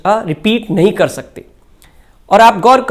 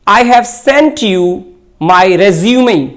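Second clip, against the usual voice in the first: slow example sentence; much slower than usual. Shown as a demonstration. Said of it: "For example".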